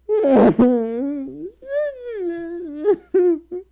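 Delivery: high laughing sob; laughing crying. Real, not acted. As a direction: laugh through tears. Overwhelmed by donation.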